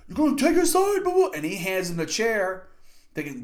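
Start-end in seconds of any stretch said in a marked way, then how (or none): 0.1-1.3 very high, strained imitation yell; cracked, hammed-up protest; Roman losing his voice. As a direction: mimic an angry wrestler whose voice is giving out. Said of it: "loses his voice a little bit 'cause he's been yelling".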